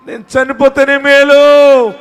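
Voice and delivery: loud, high, drawn-out wail, acted; acted wail of someone at the end of hope; despairing cry. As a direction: cry it out slowly at full voice. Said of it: "అని ఏడుస్తున్నావా".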